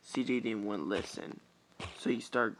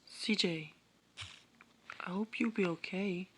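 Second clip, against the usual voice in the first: Alexis voice; 8 semitones higher than usual. Acted. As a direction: wish him well gently. Alexis speaking in next chapter.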